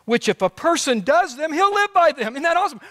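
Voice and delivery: preaching at full force. Acted. Preacher rising to a shout.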